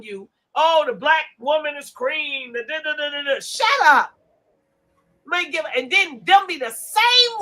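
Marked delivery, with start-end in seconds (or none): 3.4-4.1 loud snap to shut up. Real, not acted. Host telling the listeners to shut up, loudly. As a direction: cut them off, louder.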